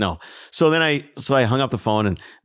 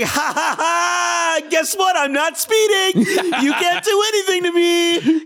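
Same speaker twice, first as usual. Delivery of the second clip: gloating triumph; slower than usual. Retold or imitated. Retelling gloating over the cop, hammed-up high triumph. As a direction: crow at the cop like you won. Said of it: "I'm like".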